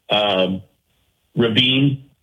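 normal talking voice

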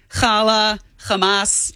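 "challah, Hamas", slowly, each starting on a guttural kh; throaty cha sound. Shown as a demonstration. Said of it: "It's the cha".

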